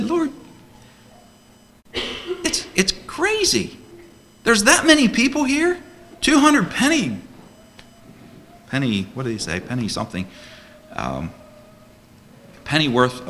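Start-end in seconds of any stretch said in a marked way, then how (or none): 0.0-7.2 much higher, astonished disbelief; incredulous disciple. Acted. Disciple balking at feeding the crowd, high incredulous protest. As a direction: gasp it out like the numbers can't be real.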